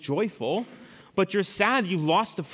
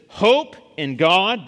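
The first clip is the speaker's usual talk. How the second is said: loud, raised, long-drawn order; emphatic command; 4 semitones higher, 8 dB louder, much slower than usual. Acted. Preacher quotes the psalm's command, loud and emphatic. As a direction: stretch it slowly and project it as a command. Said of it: "There's your command".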